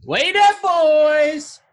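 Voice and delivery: very high, loud, slow drawn-out yell; fan shout. Acted. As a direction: bellow it like a stadium chant. Cheering for Cowboys stadium.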